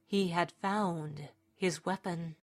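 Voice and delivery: usual speaking voice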